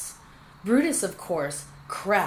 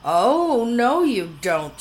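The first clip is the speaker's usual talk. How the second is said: loud, higher, slowed warning shout; 5 semitones higher, 7 dB louder, slower than usual. A narrator's reading. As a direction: shout to stop him reaching the silo. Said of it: "shouted Arabella".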